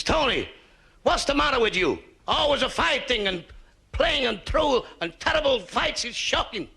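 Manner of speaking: put-on high, loud Italian-accented scolding; hammed-up Italian mama telling off her son; mock Italian accent. Mother to son at the police station, Italian-accented scolding. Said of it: "You're not Italian".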